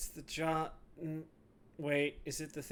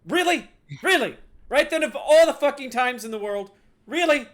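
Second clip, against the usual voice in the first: high, shouted exasperation; loud, incredulous tirade; snapping angrily; 10 semitones higher, 13 dB louder than usual. Acted. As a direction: yell in outraged disbelief. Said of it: "snapping, finally pointing at him".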